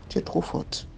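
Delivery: hushed accusation. Retold or imitated. The nanny whispering an accusation at the girl. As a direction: whisper it like a dark secret. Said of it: "elle me fait comprendre".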